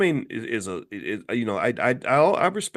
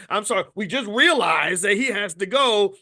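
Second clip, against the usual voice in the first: interrupting aide; 6 semitones higher than usual. Retold or imitated. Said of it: "somebody in the background has to interrupt the interview".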